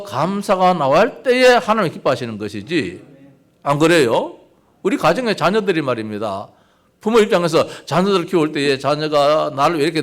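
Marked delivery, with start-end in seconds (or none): none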